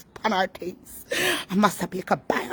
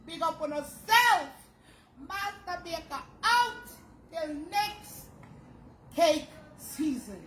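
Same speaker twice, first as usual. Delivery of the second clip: sing-song sign-off; 11 semitones higher, slower than usual. Real, not acted.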